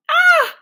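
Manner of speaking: high loud startled scream; scream of fright. A narrator's reading. Mac screams fleeing the bird, high loud shriek. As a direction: shriek and run.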